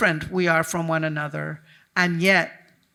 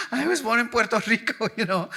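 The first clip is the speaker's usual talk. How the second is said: talking through real laughter, higher and brighter; laughing; 5 semitones higher, faster than usual. Real, not acted. To the interviewer, laughing about being born in Puerto Rico. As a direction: let the laugh spill into the words.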